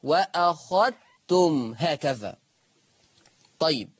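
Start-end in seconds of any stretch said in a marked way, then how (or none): 0.0-2.4 وأخذتم very slow, dhal merged into the taa. Shown as a demonstration. Teacher demonstrates idgham to students. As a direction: recite وأخذتم as wakhattum slowly. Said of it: "شوف الكلام".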